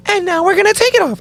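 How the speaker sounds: put-on chirpy TikTok girl. TikTok girl showing her nail to viewers, high and perky. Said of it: "And she goes".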